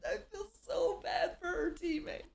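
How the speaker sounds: high squeaky laughing through words; helpless laughter while talking. Real, not acted. Cracking up at debate clip.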